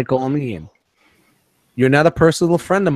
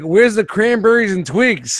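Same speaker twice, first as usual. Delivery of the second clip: higher, drawn-out teasing imitation; mock Gary; 6 semitones higher, slower than usual. Co-host joins in mocking the photo, high slow voice. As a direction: play a friend hunting for snacks, pitched up. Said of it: "he's like".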